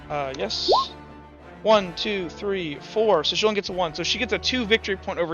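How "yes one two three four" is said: ordinary talk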